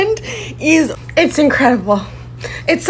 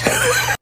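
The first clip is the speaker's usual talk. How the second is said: breathy laugh; 11 semitones higher, 5 dB quieter than usual. Real, not acted. Tickled by the discovery.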